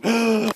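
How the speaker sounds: sharp surprised gasp; excited gasp. Real, not acted. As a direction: gasp with delight.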